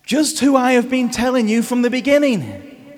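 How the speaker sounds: exasperated Jesus. Retold or imitated. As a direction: insist like a fed-up teacher. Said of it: "he must have been thinking".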